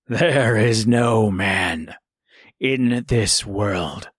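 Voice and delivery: a proud wolf sworn to duty. A narrator's reading. Wolf speaks.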